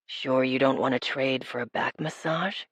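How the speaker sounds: low, hushed male voice; whispered tease. A narrator's reading. He tempts her with a back massage, low hushed whisper. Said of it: "he whispered in my ear".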